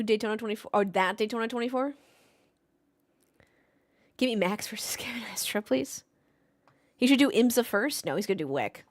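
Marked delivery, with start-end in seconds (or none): 4.2-6.0 hushed pleading whisper; breathy secret plea. Real, not acted. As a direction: whisper it like a wish. Pleading wish for matchup.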